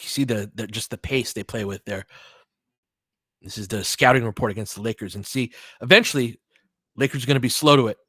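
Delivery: normal talking voice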